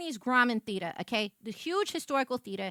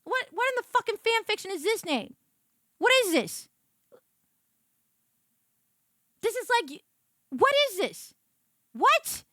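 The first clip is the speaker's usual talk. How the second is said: higher, loud exasperated questioning; mock outrage at the page; outraged disbelief; 8 semitones higher, 4 dB louder than usual. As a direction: rant at the absurd name. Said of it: "I was like".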